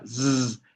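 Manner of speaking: steady voiced zzz; bee buzz. Shown as a demonstration. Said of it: "es un sonido de la abeja". (zumbido sonoro de abeja)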